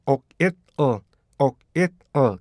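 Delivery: 옥, 잇, 어 as three short separated syllables; oil in three parts. Shown as a demonstration. Teacher reads oil part by part, segmented pronunciation. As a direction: chop oil into "옥, 잇, 어". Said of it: "세 개를 붙여주잖아요".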